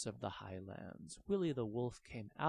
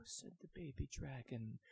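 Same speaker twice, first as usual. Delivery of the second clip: ordinary delivery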